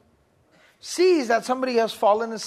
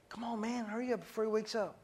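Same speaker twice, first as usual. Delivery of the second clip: pressing but hushed, much softer; urgent whisper; 12 dB quieter than usual. Retold or imitated. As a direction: hurry him along under your breath. Classmate urging in a whisper.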